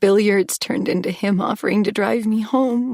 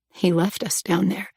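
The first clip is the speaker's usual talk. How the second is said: ordinary delivery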